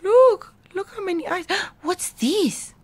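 high, hushed astonishment with a gasp; amazed wonder. Acted. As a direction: marvel with a small child at a surprise. Amazed aside at many eyes.

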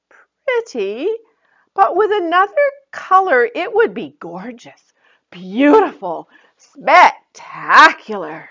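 Joy admiring the new dress. Acted. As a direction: savour each word of praise.

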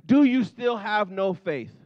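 "Do you still have no faith" slow and weighted again; emphatic again. Shown as a demonstration. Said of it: "Go one more there".

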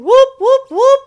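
high, loud whooping cheer. Retold or imitated. Child in the painting whooping with joy. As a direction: whoop like a kid at play. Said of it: "I hear him saying".

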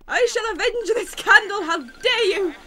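loud, theatrical battle cry; mock vengeful fury. A player vowing revenge for the candle, loudly hammed-up. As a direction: rage at the ghosts like a hammed-up hero.

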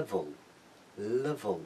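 "level" ending on a dark, held-back L; teacher's careful model of "level"; dark final L. Shown as a demonstration. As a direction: clear L opening "level", dull L closing it. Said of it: "اون در انتها قرار گرفته".

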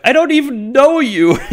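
very high, loud bewildered shout; laughing, over-the-top cry of surprise; mock shocked yell. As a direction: yell it in hammed-up disbelief. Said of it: "he's really surprised what's happening".

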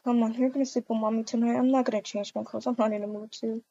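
soft, subdued murmur; mother speaking quietly to child before bed; hushed talk. Acted. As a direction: keep it down at night. Quiet talk at five in the morning.

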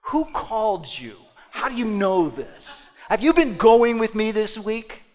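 building from a complaint to a loud, high angry shout; mock accusation rising to a yell; indignant outburst. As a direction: play the offended listener, getting hotter each question. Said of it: "They say things like".

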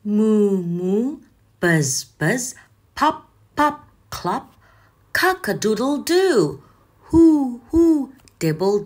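playful imitated moos, buzzes and hoots; animal and noise sounds. Shown as a demonstration. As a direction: make each noise like the creature or thing. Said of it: "Turn the page and let's review".

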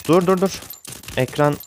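high urgent repeated stops; high-pitched alarmed "dur, dur, dur"; panicked. Real, not acted. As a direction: blurt it out in alarm. Panicking mid-fight.

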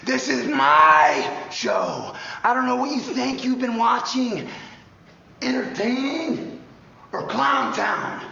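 high, loud snake boasting; loud trash talk. Acted. Cobra contestant hyping himself up, loud and high.

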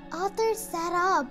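usual speaking voice

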